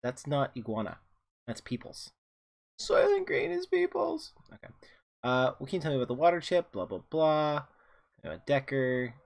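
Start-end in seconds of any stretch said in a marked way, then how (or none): 2.8-4.3 high dramatic movie quote. Retold or imitated.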